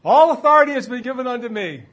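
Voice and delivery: high, fast, ringing declaration; loud proclamation. Acted. Preacher quoting Jesus to the congregation, loud proclamation. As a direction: proclaim it with full authority.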